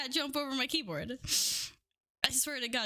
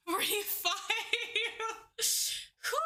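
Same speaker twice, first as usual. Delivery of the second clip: laughing through the count; breathless laughing; 6 semitones higher than usual. Real, not acted. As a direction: wheeze out a laugh on the number. Laughing mid-squat.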